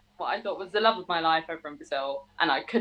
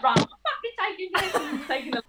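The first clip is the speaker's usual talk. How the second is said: much higher, laughing through it; laughing defiance; 8 semitones higher than usual. Retold or imitated. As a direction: laugh as you grab the bottles. Defiant retold exit, laughing.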